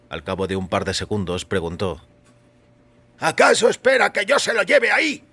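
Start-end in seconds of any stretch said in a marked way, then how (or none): 3.2-5.2 much higher, louder, sneering shopkeeper voice; the grumpy shopkeeper mocks the customer, pitched up and loud; sarcastic shopkeeper.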